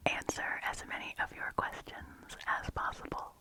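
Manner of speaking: deep, breathy repeated ASMR whisper; whispery. Real, not acted. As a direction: drop into a close tingly whisper. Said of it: "Whenever I get whispery like that".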